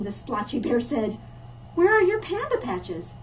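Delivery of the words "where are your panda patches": higher, louder, eager little panda; a bright, curious cub asking; friendly panda voice. Acted. Panda cub greeting the newcomer, eager and high. Panda asks about patches.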